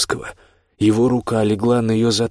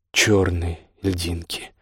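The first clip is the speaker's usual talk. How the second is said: usual speaking voice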